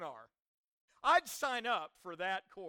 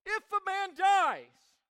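loud, high, drawn-out preacher's cry; anguished cry; 8 semitones higher, 11 dB louder, slower than usual. Acted. Preacher voicing Job's question about death, loud cry. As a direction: cry it out slowly to the rafters. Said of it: "Job's cry was this".

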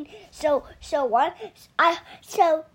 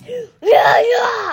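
loud mock daddy voice, pitched up; daddy bear voice; 7 semitones higher, 10 dB louder than usual. Child showing dad how he talks, loud imitation. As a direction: belt it out as a put-on daddy bear. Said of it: "you talk like this".

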